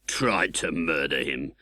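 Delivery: scornful, slow sneer; disdainful. A narrator's reading. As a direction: scoff at the claim. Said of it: "said my convict disdainfully".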